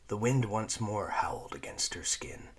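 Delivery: usual speaking voice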